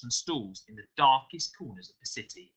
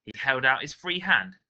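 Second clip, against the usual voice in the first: plain reading voice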